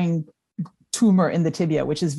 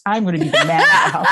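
laughing repeat; 4 dB louder, faster than usual. Retold or imitated. Daughter's quote repeated laughing.

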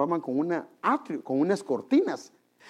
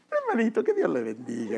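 sugary church greeting; 3 semitones higher than usual. Retold or imitated. Same man sweet greeting at church.

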